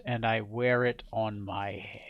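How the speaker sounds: whispered, low and cold; chilling whispered boast; menacing whisper. Acted. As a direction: breathe the last words like a threat. King's chilling climax.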